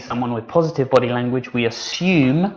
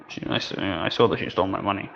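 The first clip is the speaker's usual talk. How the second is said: softer, quicker, hesitant scared voice; 4 semitones lower, 4 dB quieter, faster than usual. Shown as a demonstration. Teacher acting a frightened speaker for students, scared. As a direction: stammer it out like you're frightened. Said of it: "I'm scared".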